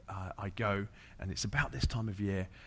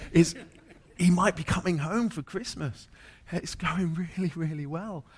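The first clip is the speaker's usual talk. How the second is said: higher, louder delighted news; bubbling over with hope; excited wife; 8 semitones higher, 8 dB louder than usual. Retold or imitated. Wife tells pastor he may be home for Christmas, higher and louder.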